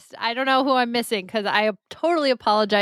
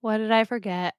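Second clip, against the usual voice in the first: ordinary talk, nothing marked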